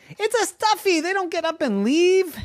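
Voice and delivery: exasperated outburst. Acted. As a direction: blurt out the obvious in disbelief.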